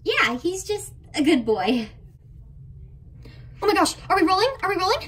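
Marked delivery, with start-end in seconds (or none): 3.6-5.1 high, flustered question; suddenly flustered on camera; startled. Acted. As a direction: caught off guard by the camera. Surprised the camera is on.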